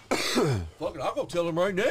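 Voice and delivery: loud, high burst of readiness; imitated eager bravado. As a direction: act out a hot-headed friend jumping up. Acting out friend ready to confront.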